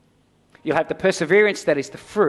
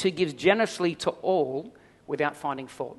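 normal talking voice

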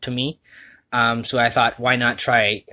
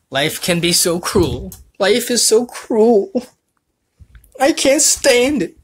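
melodramatic lament; 12 semitones higher, 6 dB louder, slower than usual. Acted. Dramatic lament with music.

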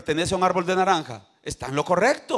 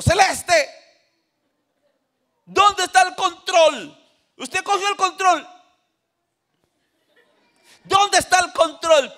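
very high, raised questioning voice; grandfather questioning toddler; 11 semitones higher, 5 dB louder than usual. Acted. Grandfather asking three-year-old Celeste, high questioning call. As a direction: quiz a small child in a high voice. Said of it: "Entonces yo le pregunto".

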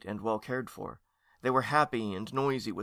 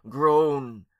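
loud, raised order; commanding; 4 semitones higher, 8 dB louder than usual. A narrator's reading. Aunt commanding young ghosts to groan, loud. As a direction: order it out loudly.

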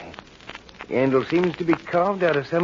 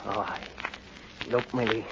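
low, very soft voice; entranced murmur; 7 semitones lower, 14 dB quieter than usual. Acted. Henry showing Millie the blade, hushed and entranced. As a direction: murmur it, lost in the knife.

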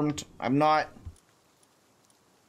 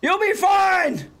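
high, loud, slow call; shouting reassurance to a pal; 12 semitones higher, 4 dB louder, much slower than usual. Real, not acted. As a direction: call the pal's name across the field. Calling to his captured pal.

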